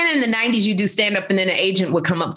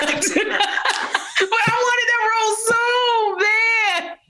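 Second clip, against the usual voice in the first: high laughing wail; cracking up while pleading; much slower than usual. Real, not acted. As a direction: laugh it out, half crying. Laughing about wanting role.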